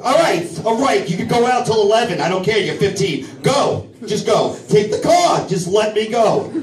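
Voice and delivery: high, strained mock pleading; desperate surrender. Dad choked by jujitsu son, strained panicked surrender.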